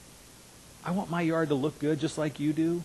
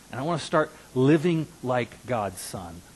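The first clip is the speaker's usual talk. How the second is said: ordinary talk, nothing marked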